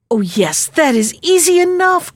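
bright-eyed eagerness, pitch leaping up; delighted cry. A narrator's reading. Princess relieved they need not part, delighted cry. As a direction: jump high on "easy enough". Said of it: "cried the princess".

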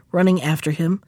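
plain reading voice

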